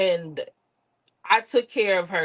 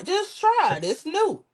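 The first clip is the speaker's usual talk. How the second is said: high, sweet coaxing to try the gum; lightly voiced mother nudging someone to try; 10 semitones higher, slower than usual. Mother coaching how to offer gum politely, sugary high voice. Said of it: "She say".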